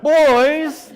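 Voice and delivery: very high, loud grandmotherly call; angry grandmother. Retold or imitated. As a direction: call the children to account in a put-on high voice. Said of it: "my brother and I would hear that".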